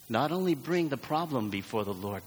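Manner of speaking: ordinary delivery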